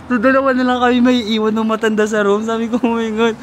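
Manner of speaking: hammed-up excited alarm. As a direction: play up the dread of sharing the room.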